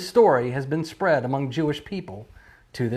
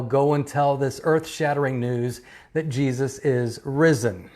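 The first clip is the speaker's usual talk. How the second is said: ordinary talk, nothing marked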